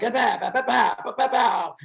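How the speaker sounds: high, popping motorbike sputter; imitated engine noise. Storyteller mimics bike after kill switch is hit, imitated engine noise. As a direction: mimic a motorcycle misfiring in vocal bursts.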